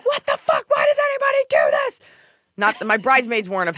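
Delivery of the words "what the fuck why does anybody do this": much higher, shouted panic; mock panicked shout. Bride on wedding day panicking, high shout. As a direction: yell it like a bride losing it. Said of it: "I was like".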